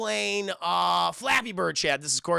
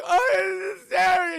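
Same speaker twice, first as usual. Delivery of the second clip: high, loud exaggerated wail; mock crying; 12 semitones higher, 8 dB louder, much slower than usual. John seeing the summoned girl, mock crying. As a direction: wail her name in fake sobs. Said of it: "He's all crying and shit".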